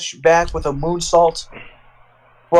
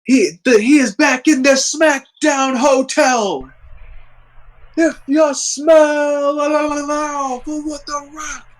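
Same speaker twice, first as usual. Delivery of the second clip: excited; 8 semitones higher than usual. Retold or imitated. Narrating Rock promo excitedly.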